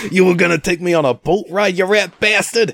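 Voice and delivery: high, loud offended gangster shout; angry mobster yell. Retold or imitated. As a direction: shout like a betrayed mob boss. Quoting mob boss outburst.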